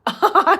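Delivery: high loud laugh bursting out; sudden loud giggle; burst of laughter. Real, not acted. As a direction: laugh hard and high at a viewer's comment. Greets viewer, bursts laughing.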